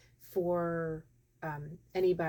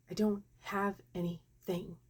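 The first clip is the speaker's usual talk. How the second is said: spaced-out word by word "I don't have anything"; slow repeat; 3 dB quieter than usual. Retold or imitated. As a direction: draw out "I don't have anything", pausing between words. Nurse repeats when asked what.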